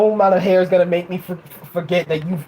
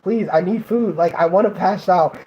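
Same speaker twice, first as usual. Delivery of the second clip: ordinary talk, nothing marked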